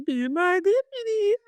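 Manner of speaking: very high, slow put-on little-girl voice; whiny childlike plea. Retelling little Willow's plea in a very high, slow, whining child voice. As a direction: beg it like a small kid. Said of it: "Willow's like".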